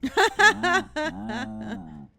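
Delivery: loud burst of laughter; real cackling laugh, trailing off. Real, not acted. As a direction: crack up out loud. Bursts out at game dialogue.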